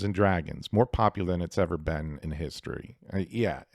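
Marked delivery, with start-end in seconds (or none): none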